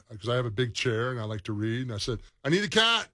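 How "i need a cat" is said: imitating himself. Quoting himself to wife.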